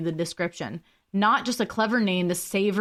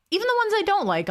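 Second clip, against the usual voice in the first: excited; 12 semitones higher, much faster than usual. Real, not acted. Enthusiastic about trying foods.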